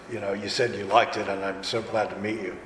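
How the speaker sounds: normal talking voice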